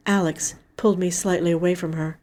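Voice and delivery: plain reading voice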